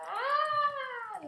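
sudden high loud shriek; startled scream. Real, not acted. Gamer jumping as spiders attack, sharp high scream. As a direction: yelp in real fright.

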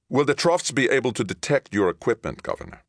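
high, urgent question; tense captain. Acted. Captain asks Johnny about hidden equipment, tense.

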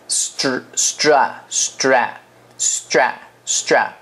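"stra-" repeated very slowly with a tight tr cluster; slow drill of str. Shown as a demonstration. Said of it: "这个C就代表T R".

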